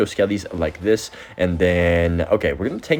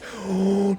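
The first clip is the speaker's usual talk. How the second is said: sharp gasp; 12 semitones higher, 4 dB quieter than usual. Real, not acted. Sudden danger on the board.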